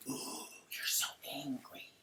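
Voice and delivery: whispered slow mock taunt; whispered impression of Lisa. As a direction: breathe it out slowly, taunting.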